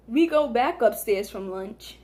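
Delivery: normal talking voice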